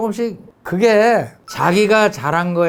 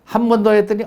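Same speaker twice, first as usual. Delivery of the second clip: normal talking voice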